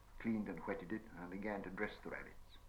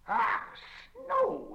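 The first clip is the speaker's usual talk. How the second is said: high squealing cry of alarm; frightened angry shriek; 10 dB louder than usual. Acted. Gollum shrieks at Sam's fire, frightened and angry. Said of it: "he cried".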